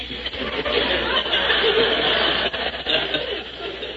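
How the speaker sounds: a high, breathy burst of laughter; hearty laugh. Real, not acted. Punchline of his own joke.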